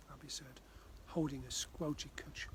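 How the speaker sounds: ordinary delivery, nothing marked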